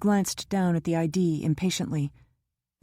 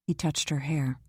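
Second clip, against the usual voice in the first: plain reading voice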